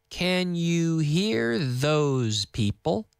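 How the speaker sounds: "Can you hear those people?" stretched out very slowly; very slow teaching model. Shown as a demonstration. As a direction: drag it out word by word. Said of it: "Y más lento".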